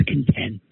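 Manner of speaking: "content" said con-TENT, meaning satisfied; second-syllable stress. Shown as a demonstration. Giving an example of the adjective, "content" stressed on TENT. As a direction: put the weight of "content" on TENT. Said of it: "which is an adjective".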